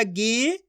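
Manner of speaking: गी held long with a rising tune. Shown as a demonstration. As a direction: stretch गी out, letting it rise. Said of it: "खींच के पढ़ा जाता है". (गी को खूब खींचकर)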